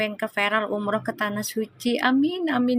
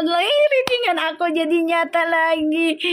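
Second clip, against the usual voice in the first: real excitement, pitched higher; thrilled, higher-pitched outburst; overjoyed; 5 semitones higher than usual. Real, not acted. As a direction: exclaim in delighted amazement. Overjoyed that her reading came true.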